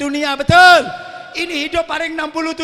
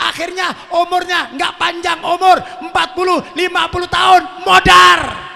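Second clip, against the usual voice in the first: loud, higher, building to a shout; fiery preacher building to a climax; preaching at full force; 5 semitones higher, 9 dB louder than usual. Acted. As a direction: crescendo of warning to the crowd. Building warning sermon.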